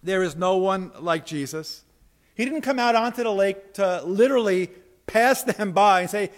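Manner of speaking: usual speaking voice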